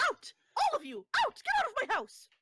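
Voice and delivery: high, loud outraged orders; shrieking. Acted. Hostess throwing the whole group out, shrieking. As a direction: shriek them out of the house. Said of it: "You can hear her shrieking".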